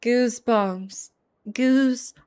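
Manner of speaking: ordinary talk, nothing marked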